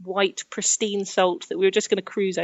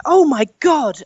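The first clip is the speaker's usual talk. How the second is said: higher, louder gasp of alarm; imitating alarmed friend; 7 semitones higher, 7 dB louder, slower than usual. Friend reading about scorpion stings, higher, louder mock alarm. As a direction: play the friend's sudden alarm. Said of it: "She was like".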